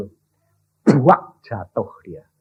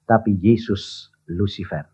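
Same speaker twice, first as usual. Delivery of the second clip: ordinary talk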